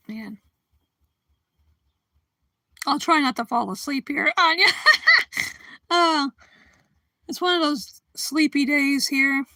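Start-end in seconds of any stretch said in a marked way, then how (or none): 4.7-5.5 high squealing giggle; a sudden high-pitched laugh bursting out. Real, not acted. Laughing at her own joke to the chat, squealing.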